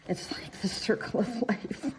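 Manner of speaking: normal talking voice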